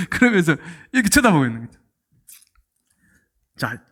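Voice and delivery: ordinary talk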